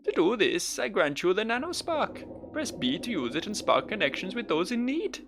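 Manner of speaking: put-on grand speech in a failed Welsh accent; Welsh accent attempt. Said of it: "going for Welsh".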